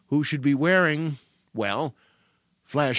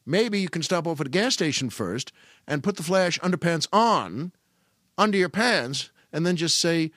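ordinary delivery

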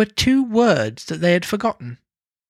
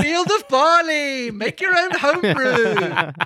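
slow, high salesman pitch; mock ad voice; 6 semitones higher, slower than usual. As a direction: sell it like a cheesy advert. Ad-style pitch for homebrew.

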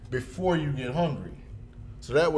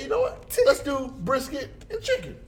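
pitched way up, eager; high eager voice; 12 semitones higher than usual. Retold or imitated. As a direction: sell the idea to yourself. Retelling choosing brisket eagerly.

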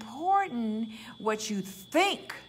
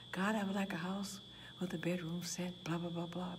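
mock whispered prayer; 4 semitones lower, 9 dB quieter than usual.

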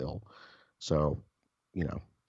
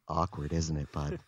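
smug boss; 3 semitones lower than usual. Retold or imitated. Boss gloating.

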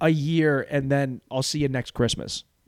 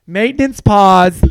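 announcer call; 6 semitones higher, 11 dB louder, slower than usual. Acted. Announcing a break.

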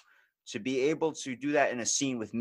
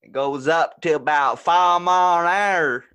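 deadpan brag in a country drawl; 8 semitones higher, 11 dB louder than usual. Acted. Joins the redneck scene.

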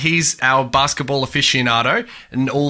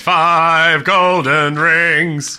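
slow grand announcer; 3 semitones higher, 5 dB louder, much slower than usual. Pretend. Mock grand award to listeners.

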